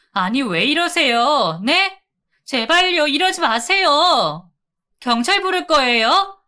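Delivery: high, loud, desperate begging; panicked pleading. Acted. Wife begging the men wrecking her shop, high loud panic. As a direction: beg them to stop, voice straining high and loud.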